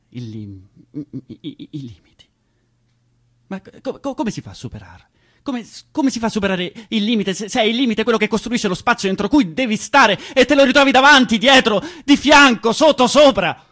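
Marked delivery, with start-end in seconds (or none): 5.4-13.6 rising to a high full shout; building outburst. Acted. Actor rails at the walls of limits, building to a shout. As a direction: pile it on until you're yelling.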